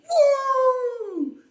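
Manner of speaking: very high, loud whooping cheer; hammed-up crowd cheering. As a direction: whoop like a hyped-up war party. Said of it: "everybody is like".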